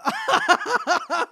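very high burst of laughter; laughing. Real, not acted. Viewer cracking up at a character, high laughing. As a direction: cackle helplessly, high and quick.

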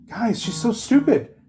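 very high, drawn-out incredulous whine; mock exasperation. Quitting trainee saying bye to everybody, high-pitched exasperation. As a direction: announce it slowly in a high imitated voice. Retold parting remark to coworkers.

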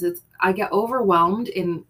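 ordinary talk

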